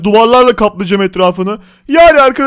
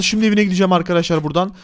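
ordinary delivery

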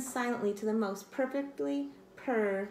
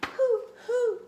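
high, louder hooting; owl hoot; 13 semitones higher, 6 dB louder than usual. Acted. Owl answers tired kitten with hoots. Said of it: "Owl said".

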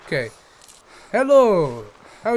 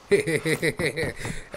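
mocking laugh; 4 semitones lower, 3 dB quieter than usual. Laughs at own joke.

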